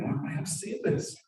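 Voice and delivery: high, soft, slow insistence; mildly acted exasperation, drawn out; a bit exasperated. Friend on top insists to doubter below, a bit exasperated. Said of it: "he gets a bit exasperated".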